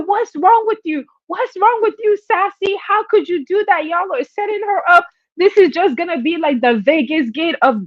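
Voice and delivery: high, indignant mock crowd voice; outraged chatters. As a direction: play the scandalized viewers ranting. People outraged at the trip.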